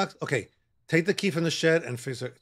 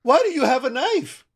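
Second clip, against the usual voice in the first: higher and louder, rising demand; loud alarmed question; 10 semitones higher, 7 dB louder than usual. Real, not acted. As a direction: shout the question at her. Asks the same question louder.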